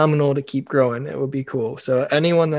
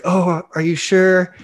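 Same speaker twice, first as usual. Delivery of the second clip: slow, higher, drawn-out fake sympathy; mock concern; 5 semitones higher, 4 dB louder, slower than usual. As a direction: stretch it out as if sorry to hear it. Feigned concern over returned dish.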